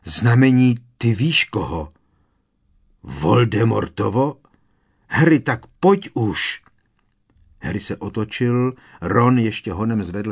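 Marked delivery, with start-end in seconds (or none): 0.0-6.6 louder, pleading in fright, rising on the end; frightened urging. A narrator's reading. Hermione pulls Harry away from the skull, frightened and urgent. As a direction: hurry him along in alarm.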